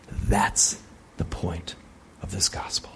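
low breathy whisper for emphasis. Real, not acted. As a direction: drop it to a whisper.

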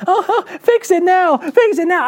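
mock a panicked believer begging; hammed-up whiny begging; whiny plea. Mimicking panicked lazy Christians.

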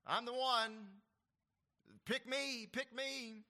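loud, high, pushy self-promotion; hammed-up eager "pick me" braggart; mock eager boaster. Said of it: "He doesn't say".

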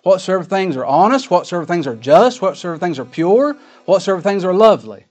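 loud, ringing scripture recitation, pitched high; preaching scripture. Acted. Preacher quoting the verse to his congregation, emphatic scripture reading. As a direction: drive through the list, lifting each item.

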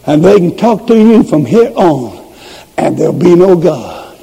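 ordinary talk, nothing marked

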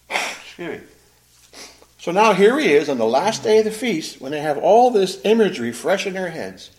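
ordinary talk

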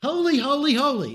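holy, holy, holy rising high and ringing; triple holy. Shown as a demonstration. Preacher showing Hebrew degree to congregation, high proclaiming. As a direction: let each holy climb. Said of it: "in the Hebrew, to talk about degree".

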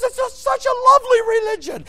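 high, loud mocking imitation of grandmother; mock the sweet old grandma; grandma's voice. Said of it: "she says".

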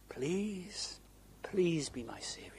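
hushed, imploring prayer; earnest plea. Acted. Preacher ending a seeker's prayer for salvation, hushed plea. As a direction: beg softly, voice dropping away. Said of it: "We need to be earnest".

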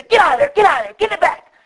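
irritated father shouting at his son; angry dad yell. Retold or imitated. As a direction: bark the kid out of the van.

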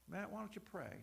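soft, gentle nudge, voicing God's prompt; hushed inner prompting. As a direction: drop the volume and prompt softly. Retells the Spirit's inner prompting.

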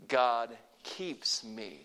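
very slow, words drawn out; slow call to repeat. Shown as a demonstration. Preacher leading the congregation to repeat slowly. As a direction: lead them through it gently. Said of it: "Can you say it with me?".

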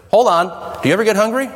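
normal talking voice

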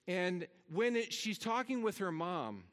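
usual speaking voice

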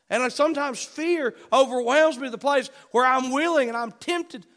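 fired-up preacher; impassioned preaching. Real, not acted. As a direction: build into loud, urgent testimony from the pulpit. Preaches at full force.

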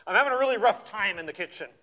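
pointed, loud complaining for all to hear; mock-loud kitchen grumble; loud complaint. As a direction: make the whole house hear it. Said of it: "making sure everybody knows".

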